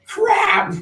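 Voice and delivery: a sudden high shout of frustration; shouted curse. Retold or imitated. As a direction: yell it out as the wave knocks you over. Hit by wave, standing up.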